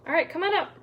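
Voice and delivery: ordinary talk, nothing marked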